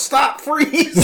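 high imitated police command; mock cop shout. Cop yelling after a racing kid, mock shout. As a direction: call out like a cop halting a runner. Acting out cop in race joke.

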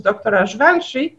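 usual speaking voice

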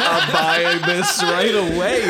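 high, loud, slow laughing. Real, not acted. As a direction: blurt it out while laughing hard. Cracking up at the pitch.

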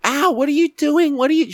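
high, squealing mock victim; high mock-wounded whine; whiny shoplifter. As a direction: squeal it like a caught thief playing hurt. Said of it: "the woman's like".

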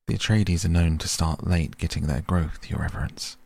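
usual speaking voice

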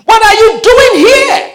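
high, loud shocked yell. Retold or imitated. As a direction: cry out in horror at his brothers. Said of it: "I yelled".

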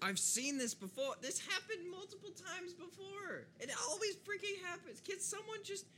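high, exasperated complaining; exasperated whine. Acted. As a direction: whine it, fed up.

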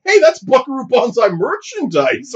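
high, excited "hey" of recognition; excited fan. Retold or imitated. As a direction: gush like a starstruck fan. Fans recognizing the hero.

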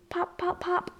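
ordinary delivery

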